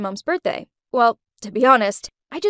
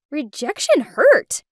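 plain reading voice

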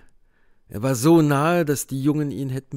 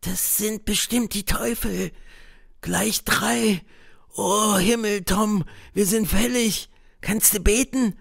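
put-on higher boy's voice, a touch fearful; a scared boy, understated; mild trembling fear; 8 semitones higher than usual. A narrator's reading. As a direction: Huck shivering with fear at the devils. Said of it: "flüsterte Huckleberry Finn, schlotternd vor Angst".

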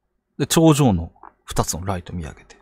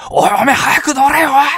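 high, loud bark to get in the car; frantic shout from the car door; angry yelling; 6 dB louder than usual. Retold or imitated. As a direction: shout at him to get in now. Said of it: "怒鳴り散らしてる".

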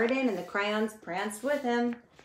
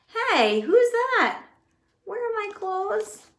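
very high, loud excited squeal; excited crayon; 12 semitones higher, 8 dB louder, slower than usual. Acted. Green crayon jumping out of the box, squealing. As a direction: bounce out full of glee. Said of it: "said the green crayon".

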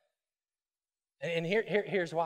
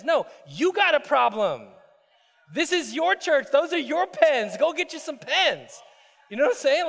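high, loud, emphatic preaching; full-force preaching; 9 semitones higher, 7 dB louder than usual. Acted. Pastor confronts the congregation about the pens, full-force preaching. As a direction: drive it home to the crowd.

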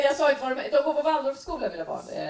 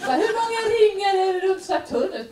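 put-on higher, louder nagging kid voice; child's question; 8 semitones higher, 5 dB louder than usual. Kid nagging rushed mom, high and loud.

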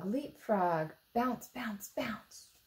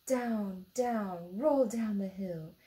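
usual speaking voice

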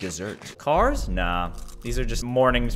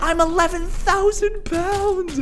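much higher, slow, drawn-out yell of glee; ecstatic shout; 4 dB louder, slower than usual. Real, not acted. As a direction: stretch each word up high, losing it. Baby reaches eleven thousand pounds.

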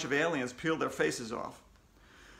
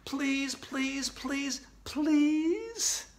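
high, repeated pleading; begging; 10 semitones higher, much slower than usual. A narrator's reading. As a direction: plead on one high note, stretching the last word. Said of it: "we all begged".

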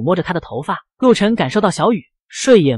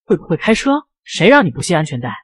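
ordinary delivery, nothing marked

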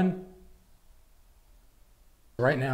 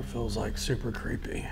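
whisper; 4 semitones lower, 9 dB quieter than usual. Real, not acted. Alone in dark haunted room.

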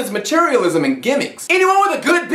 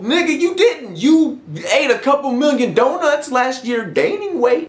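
ordinary delivery

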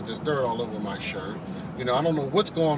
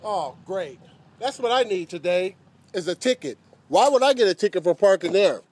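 sarcastic; 6 semitones higher than usual. Acted. Driver complains about parking ticket.

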